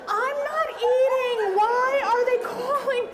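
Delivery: hammed-up panicked protest; panicked inner voice. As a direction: wail it like you're being wronged. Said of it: "I'm thinking".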